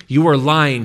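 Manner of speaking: high, loud accusation; angry defiance. A narrator's reading. Meg hurls the accusation at her possessed brother, loud and angry. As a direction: fling it back at him, furious.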